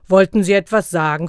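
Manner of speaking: lightly deepened, loud challenge; deep Santa voice. A narrator's reading. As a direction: challenge him like a menacing Santa. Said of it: "mit tiefer Stimme".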